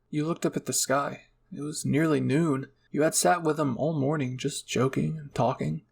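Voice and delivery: plain reading voice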